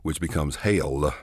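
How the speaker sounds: "hail" drawn out like a preacher's "hell"; televangelist drawl. Shown as a demonstration. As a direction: stretch "hail" slow and long. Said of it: "elongate those vowels with words like hell".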